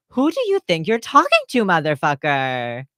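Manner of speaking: high, loud indignant challenge; indignant outburst. Acted. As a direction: burst out high, then drop on the last word. Acted angry comeback in argument.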